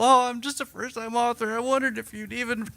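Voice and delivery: high-pitched mock newbie author; put-on high voice for a hesitant novice; rambling first-time author. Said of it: "We didn't just ramble".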